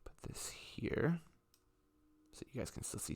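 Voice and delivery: hushed aside while setting up; just breathe the words; whispering. Real, not acted.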